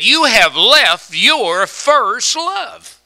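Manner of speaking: loud, high and drawn out, word by word; emphatic scripture quote. Retold or imitated. Preacher delivering the rebuke to the church, emphatic and loud. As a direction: preach each word slowly at full volume. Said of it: "You know how he really said it?".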